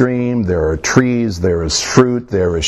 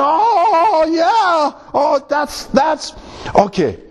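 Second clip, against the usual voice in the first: very high-pitched tearful wail, hammed up; imitating himself crying at a film; mock crying; much slower than usual. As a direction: blubber it in a squeaky mock sob. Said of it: "I cried, and I'm a guy".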